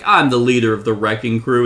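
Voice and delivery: loud mock villain boast; lightly imitated bragging villain; boastful villain quote. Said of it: "He's like".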